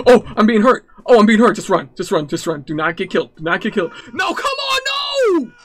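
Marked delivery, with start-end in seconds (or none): 0.1-1.6 loud, high panicked cry of pain; panicked yelp. Real, not acted. Gamer getting hurt by the monster, loud panicked yelp. 4.1-5.5 shrieking high panicked shout. Real, not acted. Gamer shrieks as the monster catches him, panicked shout. Player dying in horror game.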